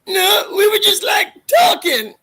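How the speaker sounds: very high, loud, whiny cadet; Bobcat character voice. Retold or imitated. Retelling the pool scene, very high loud whiny Bobcat. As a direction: wail it like a panicked nerdy cadet. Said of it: "Bobcat goes".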